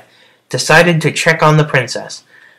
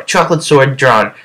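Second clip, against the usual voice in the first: normal talking voice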